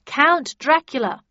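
loud, high award reveal; announcer reveal. Acted. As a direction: build suspense for the winner. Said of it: "announced the M C".

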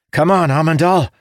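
urgent shout up the stairs; calling out. A narrator's reading. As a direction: call him on, loud and high.

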